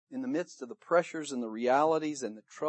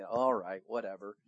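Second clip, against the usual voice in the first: low, soft, dismissive sarcasm; sarcastic compliance; 7 semitones lower, 6 dB quieter than usual. Acted. Weary fishermen answering the man on shore, sarcastic compliance. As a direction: give in under your breath, unconvinced. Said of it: "hear the sarcasm in their voices".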